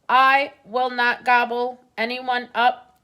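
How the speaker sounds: robot voice. A narrator's reading. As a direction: reassure them like a robot. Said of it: "said Roz".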